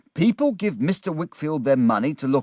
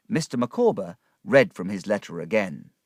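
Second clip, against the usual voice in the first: ordinary delivery, nothing marked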